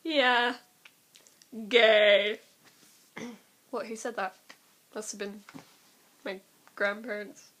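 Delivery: ordinary talk, nothing marked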